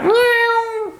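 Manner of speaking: high, long cat meow. Pretend. Teacher imitating a cat for pupils, cat meow. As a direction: mew like a cat.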